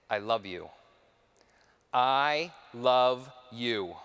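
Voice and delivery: low, deliberate declaration, then drawn out word by word; firm, not soft. Retold or imitated. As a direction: say it steadily, with teeth. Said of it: "not a weak or a soft".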